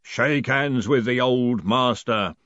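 slow, drawn-out ritual voice; solemn voice out of the dark; voice in the darkness. A narrator's reading. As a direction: intone it slowly, like a lodge master. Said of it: "a voice in the darkness had said".

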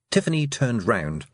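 plain reading voice

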